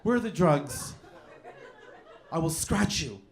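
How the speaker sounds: lightly camp disguised superhero; prim, mock-serious menace; effeminate Catman voice. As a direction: play a fussy, dainty vigilante making threats. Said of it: "I'm saying like this for some reason".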